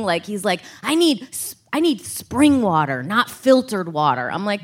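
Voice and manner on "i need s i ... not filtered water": lightly voiced grumbling father; a hint of a demanding old dad; complaining dad. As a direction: imitate a dad making a fussy demand. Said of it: "he's, like, always complaining".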